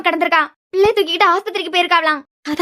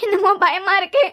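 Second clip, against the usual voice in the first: tearful, frightened; 2 semitones higher than usual. Acted. Young woman telling her brother the child won't respond, crying. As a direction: voice choked with tears and fear. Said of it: "என்ன, ஏன் அழுற?".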